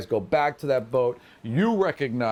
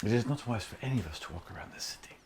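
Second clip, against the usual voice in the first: hushed conspirator; 5 semitones lower, 9 dB quieter, faster than usual. Acted. GM plays Jostra, hushed urgency.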